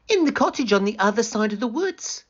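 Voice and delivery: put-on high, fast, chirpy girl voice; cheerful little girl. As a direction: answer brightly and innocently. Girl's voice in story.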